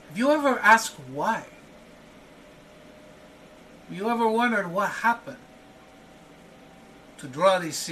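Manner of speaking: high, pointed questions; challenging host. Acted. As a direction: needle him about the feud. Host challenges old feud.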